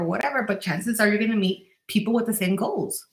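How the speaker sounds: ordinary talk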